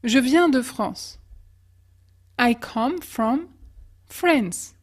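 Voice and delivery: ordinary talk, nothing marked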